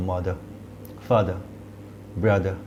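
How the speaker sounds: mother ends in a short আ, no r, like মাদা. Shown as a demonstration. Teacher showing British pronunciation to learners. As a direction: drop the r, end on a short আ. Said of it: "এই সিম্বলটা use করি".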